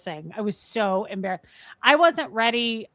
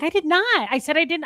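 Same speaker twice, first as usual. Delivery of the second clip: high-pitched retold protest; mock-offended insistence; indignant denial; 8 semitones higher than usual. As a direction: protest your innocence, pitch jumping up.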